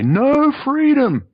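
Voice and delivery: very high, very slow, put-on voice; quoting the captors. The people sent to pick him up, quoted in a drawn-out high put-on voice. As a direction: stretch it out high and strange. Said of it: "That's a quote".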